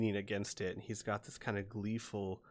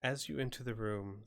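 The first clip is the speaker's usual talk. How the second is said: ordinary delivery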